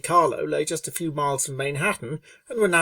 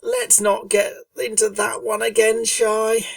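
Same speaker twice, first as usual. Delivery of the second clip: higher, louder eye-rolling pony voice; exasperated Rainbow Dash; 7 semitones higher, 4 dB louder, slower than usual. A narrator's reading. Rainbow Dash cutting off Fluttershy's pronoun joke, higher exasperated voice. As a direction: brush it off with a weary huff. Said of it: "Rainbow rolled her eyes".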